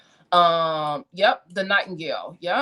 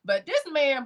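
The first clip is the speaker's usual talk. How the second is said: normal talking voice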